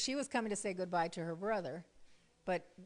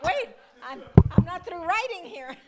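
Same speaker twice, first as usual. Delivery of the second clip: louder and higher, flustered; loud mock protest; 8 semitones higher, 16 dB louder than usual. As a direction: hold them off, half laughing. Busy, everyone calling her.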